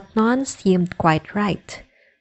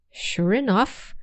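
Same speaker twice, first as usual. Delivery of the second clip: ordinary delivery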